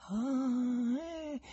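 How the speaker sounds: long, high moan of distaste; drawn-out high "ah, eh" with a grimace; thin, high noise of displeasure; grimacing groan. A narrator's reading. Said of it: "fece l'ospite con una smorfia".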